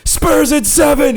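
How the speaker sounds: high, defiant wrestler yell; defiant promo, stretched at the end; Rob the Slob. Retold or imitated. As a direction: imitate a heel wrestler taunting the crowd. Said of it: "the Rob the Slob goes".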